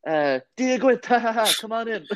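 high, gushing tout voice; mock a pushy bar promoter; hammed-up friendly street tout; overeager promoter. Promoter flyering tourists, acted quote.

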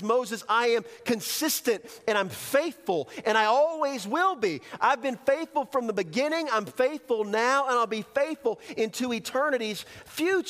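high, impassioned preaching declaration; fervent, insistent proclamation; God's assurance. Acted. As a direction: build emphatic promises, pitch held high. Preacher speaks as God to Moses.

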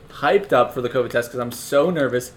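normal talking voice

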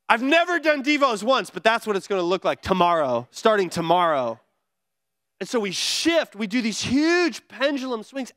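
ordinary talk

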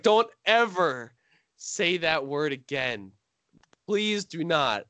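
slow, drawn-out plea; a daughter pleading with her dad, slow. Retold or imitated. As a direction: spell it out slowly, begging.

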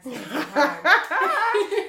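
drawn out, high, loud, laughing plea; begging dad for cash, hammed-up whine; whiny begging. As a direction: stretch it slow like a cheeky beg.

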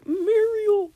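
high, loud cartoon wail; cartoon call. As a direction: cry out the name like a cartoon character. Imitates cartoon character calling Muriel.

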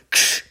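imitated burst of bullhorn static; short "ksh" of mock radio static; microphone static click. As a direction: mimic a loudspeaker crackling on. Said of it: "like, the fucking microphone".

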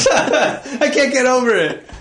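laughing while talking; words broken up by laughter. Real, not acted. Laughs at being teased.

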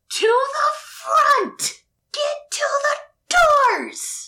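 huge, bellowing bull-man shout; big Minotaur voice. Pretend. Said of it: "in his big Minotaur voice".

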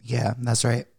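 hoarse, sick voice. Real, not acted. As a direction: sound like you have a cold. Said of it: "you sound really sick".

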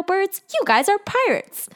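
sudden high-pitched excited reveal; excited realization. Retold or imitated. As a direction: jump up in pitch as the penny drops. Sokka unmasks the pirates.

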